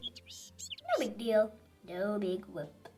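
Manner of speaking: usual speaking voice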